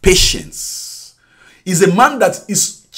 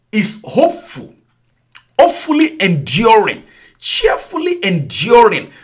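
ordinary delivery

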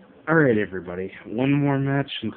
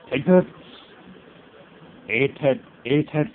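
sharp loud shout, pitched up; loud battle cry; 5 semitones higher, 6 dB louder than usual. Real, not acted. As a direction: hurl it at the opponent. Attacking in game.

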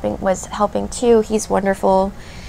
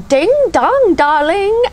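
much higher, posh-sounding British judge voice, badly done; hammed-up British accent, sing-song rise; first try at a camp British accent, much higher and louder; Val's British accent; 10 semitones higher, 4 dB louder, slower than usual. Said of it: "have a terrible British accent".

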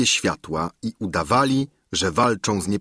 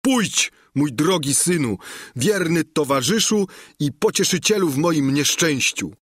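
higher, tender tearful voice; ze łzami w oczach; 7 semitones higher than usual. A narrator's reading. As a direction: speak to the donkey with love and grief. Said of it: "rzekł ze łzami w oczach". (czule, przez łzy)

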